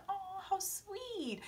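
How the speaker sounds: dreamy soft aww; soft swoon. Retold or imitated. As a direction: sigh it out like melting. Swooning over kiss.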